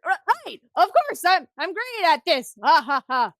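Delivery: loud, high bluster with forced laugh; hammed-up boast ending in a fake laugh; flustered bragging. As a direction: bluff confidence after your own shock. Shocked sniper covers with bragging.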